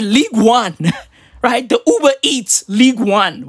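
ordinary talk

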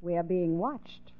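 spooky warning. Acted. Girl suddenly turns ominous.

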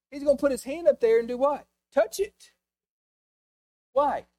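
loud, high, driving preacher voice; fiery sermon delivery; preaching. Acted. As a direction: preach it hard to the congregation. Preaching at full force.

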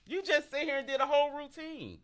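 high, excited disbelief; playful high-pitched teasing. Real, not acted.

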